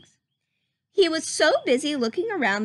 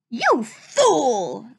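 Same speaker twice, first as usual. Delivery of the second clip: louder angry growl as Pete; 5 dB louder than usual. Acted. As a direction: snarl it at the fool. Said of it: "growled Captain Pete".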